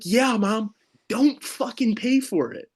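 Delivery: high-pitched retold exasperation at mom; exasperated reply. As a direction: plead with mom in mock exasperation.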